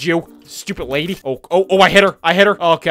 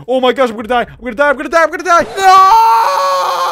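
rising from loud alarm to a screamed wail; doomed gamer's cry; mounting panic; 11 semitones higher, 8 dB louder than usual. Real, not acted. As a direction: repeat frantically, climbing higher into a yell. About to die in game.

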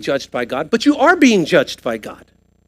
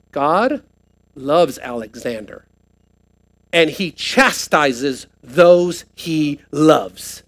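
slow, softer, lower delivery; tender explanation; 5 semitones lower, 4 dB quieter, much slower than usual. Acted. Preacher voicing Paul's heart toward Alexander, slow and tender. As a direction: let it land slowly and gently. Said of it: "He's saying it like this".

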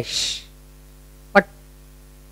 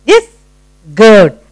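usual speaking voice

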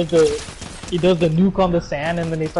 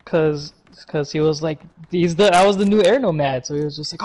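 normal talking voice

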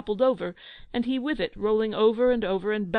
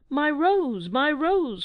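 a higher, louder cry for the lost rose; 6 semitones higher, 4 dB louder, much slower than usual. Acted. Bulbo crying out for his fallen rose, higher and louder. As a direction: wail it out, panicked. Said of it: "cried Bulbo".

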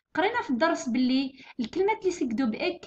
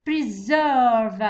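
Preserve with a loud, long 'serve'; stress on SERVE; 2 semitones lower, 5 dB louder than usual. Shown as a demonstration. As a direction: punch and hold the second syllable of Preserve. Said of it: "الستريس يجي في الجزء الثاني تاعو".

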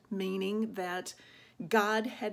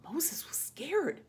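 higher, hushed and slow; scared narration; 7 semitones higher, slower than usual. A narrator's reading. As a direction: tell it in a frightened near-whisper. Narrating Moses's fright.

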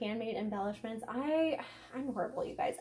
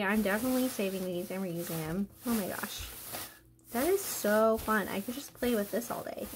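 normal talking voice

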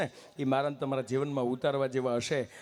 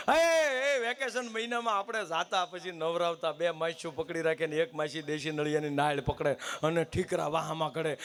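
ordinary talk, nothing marked

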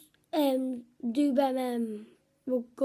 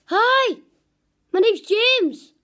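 higher, louder put-on friend voice; chirpy high impression of a mate; imitating James, pitched up and loud; 6 semitones higher, 6 dB louder, slower than usual. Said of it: "Right, this is it".

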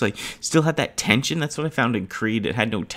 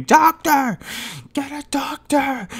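panicked call, pitched way up; screaming reporters; slower than usual. Retold or imitated. Reporters at ringside screaming for a doctor, high frantic yell. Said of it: "they're screaming".

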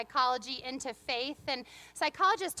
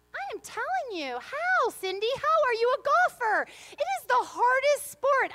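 much higher and louder, playful exasperation; exasperated outburst; 10 semitones higher, 7 dB louder than usual. Real, not acted. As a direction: throw up your hands about golf. Laughing exasperation about golf.

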